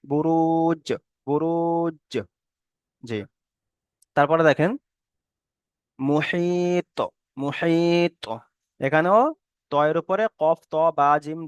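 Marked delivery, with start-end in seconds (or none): 0.0-2.1 বুরুজ with long level "ruu", then a bounced j; qalqalah on jim. Shown as a demonstration. Teacher modelling two sakins together, বুরুজ drawn out with a bouncing j. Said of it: "আর একটা জিমের মতো উচ্চারণ হচ্ছে". 6.0-8.2 মুহিত with long level "hii", then a bounced heavy t; qalqalah on ত. Shown as a demonstration. Teacher modelling qalqalah, মুহিত drawn out with an echoed t. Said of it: "মাখরাজের সাথে লাগিয়ে একটু আলাদা হয়ে যাবে".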